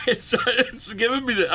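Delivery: breathless, high pained laugh. Real, not acted. Streamer laughing from sore nuts, high and pained. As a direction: laugh it off through the hurt. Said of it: "this is how I laugh when it happens".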